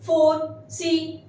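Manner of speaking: very slow, syllables apart; slow model word. Shown as a demonstration. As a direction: say it slowly for learners. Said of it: "teacher models word slowly".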